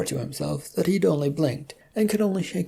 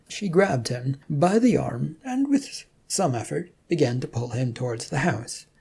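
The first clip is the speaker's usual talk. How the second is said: plain reading voice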